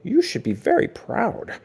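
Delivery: a hint of a high praising teacher; proud teacher. Mister Hoppypot telling Jasper to be proud, lightly high. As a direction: beam at the student. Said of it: "cried Mister Hoppypot".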